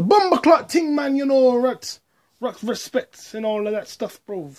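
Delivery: loud, high-pitched mock Jamaican accent; mock Jamaican patois. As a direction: put on a hyped-up Jamaican patois. Mimics Tom Hanks' son's patois.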